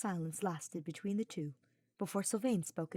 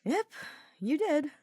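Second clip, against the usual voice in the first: high, gleeful teasing; snickering; 10 semitones higher, 6 dB louder than usual. Acted. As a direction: snicker through the words. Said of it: "Sylvain snickered".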